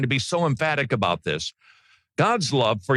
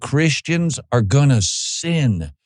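louder, driving pulpit voice; preaching at full force; 6 dB louder than usual. Real, not acted. Preacher warning the congregation against sin, forceful. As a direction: preach it hard and emphatic.

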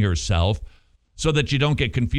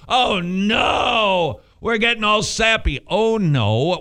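mock alarm; 8 semitones higher, slower than usual. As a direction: pretend horror, thrown high. Mock dread repeated.

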